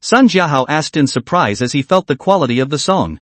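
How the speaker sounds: ordinary delivery, nothing marked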